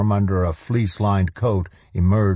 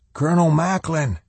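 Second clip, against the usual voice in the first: high-pitched call to a colonel; 9 semitones higher than usual. A narrator's reading. Soldier hailing the colonel over passing trucks, calling out. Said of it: "a voice called over the rumble of passing trucks".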